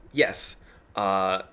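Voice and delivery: ordinary talk, nothing marked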